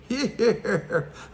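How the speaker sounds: high goofy cackle in a put-on voice; goofy laugh impression. Imitating Zoe's goof laugh for the listener, high goofy cackle. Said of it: "Zoe had that old goof ass laugh".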